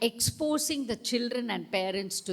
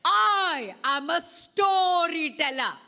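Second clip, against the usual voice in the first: louder and higher, slow and grand; proud storyteller voice; 6 semitones higher, 5 dB louder, slower than usual. Shown as a demonstration. Teacher leading the class call, grand and slow. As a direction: announce yourself grandly and slowly.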